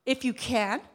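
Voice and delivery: "If you can" asked back with rising "can"; questioning rise. Retold or imitated. Preacher pointing out the question mark, rising tune on "can". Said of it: "It's a question".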